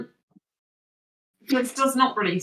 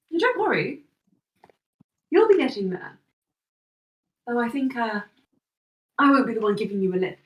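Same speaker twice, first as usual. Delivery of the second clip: lightly voiced smiling Sorella; faster than usual. Sorella smiles at anxious Loran, lightly reassuring.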